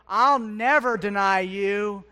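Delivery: loud, slow, proud declaration; emphatic vow. Acted. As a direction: declare it forcefully, stretching the words. Acting Peter's proud vow.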